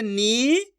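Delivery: नी held long with a rising tune. Shown as a demonstration. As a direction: stretch नी out, letting it rise. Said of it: "तो ना को खींच के पढ़ेंगे". (नी को खूब खींचकर)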